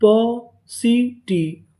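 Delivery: पॉसिटी drawn out as पॉ, सी, टी; syllable by syllable. Shown as a demonstration. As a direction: sound out पॉसिटी very slowly with clear gaps. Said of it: "तो पूरी meaning क्या बनी". (पॉसिटी को खींचकर, टुकड़ों में)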